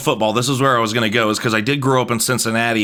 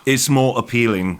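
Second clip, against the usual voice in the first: bad British accent. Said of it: "That was the worst".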